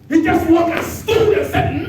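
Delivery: high, loud, slow preaching shout; full-force preaching. Real, not acted. Preacher building to the quote, loud and slow. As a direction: drive each word home.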